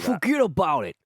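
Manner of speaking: high, hammed-up New Jersey accent. Co-host parodying the author's American voice, broad New Jersey accent. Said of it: "Generic [name] accent".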